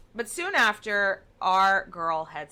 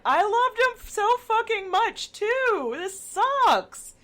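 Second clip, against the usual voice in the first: high, louder put-on wail, hammed-up crying; mock blubbering; 6 dB louder, slower than usual. Sasha crying to Dagmar over his dead brother, hammed-up high wail. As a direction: whine it like a big man bawling like a baby. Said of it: "Sasha starts crying, and he's just like".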